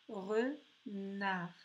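"renard" as re, pause, nard; slow "renard" with a gap before nard; split into syllables. Shown as a demonstration. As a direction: separate the two syllables of "renard". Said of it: "dans la deuxième syllabe".

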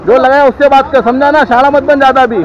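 loud, high, confrontational warning; angry warning. Real, not acted. Rider warning pump worker not to act smart, angrily. Real argument with petrol pump staff.

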